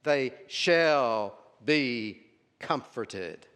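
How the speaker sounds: "shall" very long and loud, then a short pause before "comforted"; certainty. Shown as a demonstration. Preacher explaining that future tense shows certainty, stress on "shall". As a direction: declare it with certainty, leaning hard on "shall". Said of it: "It's with certainty".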